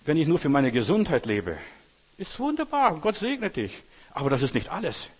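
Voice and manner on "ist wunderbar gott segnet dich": ironic praise. Acted. Ironic praise of health-only life.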